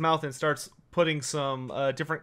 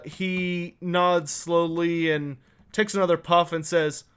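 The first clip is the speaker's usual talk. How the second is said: normal talking voice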